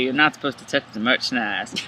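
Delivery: low, drawn-out celebrity impression; Matthew McConaughey. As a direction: imitate a laid-back movie star's commercial line. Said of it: "that commercial where he's like".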